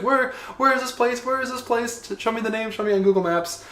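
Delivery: high-pitched imitation of eager askers; clamoring followers. Imitating everyone online asking for the matcha place, high pestering voice.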